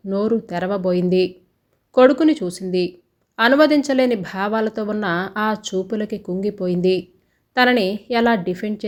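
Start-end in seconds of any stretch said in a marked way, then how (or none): none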